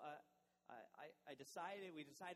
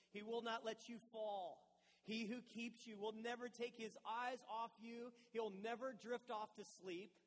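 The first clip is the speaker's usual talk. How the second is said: scripture reading; 4 semitones higher, 5 dB louder than usual. Acted. Pastor reads Psalm 121 to his church, earnest and raised. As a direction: proclaim the psalm to the congregation.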